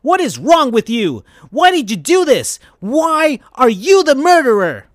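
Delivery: hammed-up outraged shout; accusing. A narrator's reading. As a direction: demand answers from the killer. Hero confronting the murderer.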